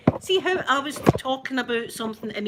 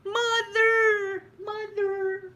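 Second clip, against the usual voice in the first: high, loud fawn's cry for its mother; Bambi calling mother; 12 semitones higher, 4 dB louder, much slower than usual. Retold or imitated. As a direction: call out like a lost fawn. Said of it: "like that".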